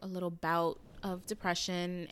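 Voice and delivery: ordinary talk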